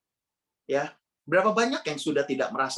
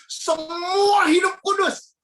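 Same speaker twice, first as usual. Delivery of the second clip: mock exclamation; 13 semitones higher, 6 dB louder, much slower than usual. Mocking everyone being holy.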